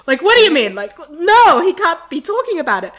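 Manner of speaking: loud, high retold protest; horrified disbelief. Fan hearing from the girls that he kept talking, horrified disbelief. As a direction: cry out in mock-horrified denial.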